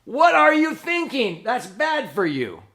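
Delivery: high loud yell that drops off fast; angry parent. Retold or imitated. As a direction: snap at the kids, then ease down. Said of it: "And then you stuff out your own cigarette".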